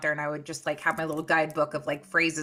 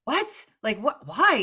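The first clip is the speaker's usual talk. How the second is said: sharp high "What?", then a baffled "why?"; can't believe what you just heard; high incredulous yelp; shocked disbelief; 7 semitones higher than usual. Retold or imitated. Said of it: "we were like".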